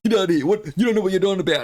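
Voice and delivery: high, rapid-fire mock outburst; agitated protest. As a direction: act out someone squawking in protest. Acting out agitated people being told.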